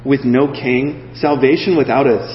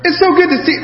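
high, gushing hello; acted, over-sweet excited greeting; mock-delighted greeting; 13 semitones higher than usual. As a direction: gush it in a high voice. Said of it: "Mary was just like".

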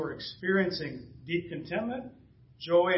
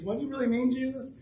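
mock-timid, pitched up high; timid question; 7 semitones higher than usual. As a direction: ask it nervously, in a higher voice. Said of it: "I'm afraid to ask Jesus".

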